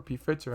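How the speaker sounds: "P Pfizer" said pee-FITS-er, softly offered as an option; "P Pfizer" with a short i and ts; alternative pronunciation. Real, not acted. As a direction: say "P Pfizer" as pee-fitser, dropped in quietly. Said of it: "however you wanna go ahead and, uh, pronounce it".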